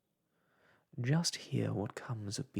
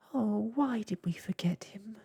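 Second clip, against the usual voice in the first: much higher, whispered moan; 9 semitones higher than usual. A narrator's reading. Snugglepot regretting the friend left behind, a high whispered moan. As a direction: lament it in a breathless whisper. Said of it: "moaned Snugglepot".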